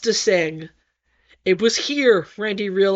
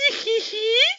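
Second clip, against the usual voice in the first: high giggle; 11 semitones higher than usual. Acted. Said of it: "feigning innocence".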